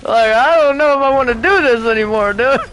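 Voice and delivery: high-pitched, half-laughing alarm; mock panic. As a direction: squeak it out like you're scared of the needle. Retold own panic continues.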